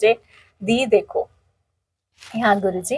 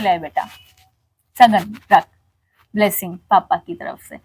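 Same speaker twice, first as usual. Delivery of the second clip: tender father; 6 semitones lower, 4 dB quieter than usual. Retold or imitated. Father lovingly giving blessing money.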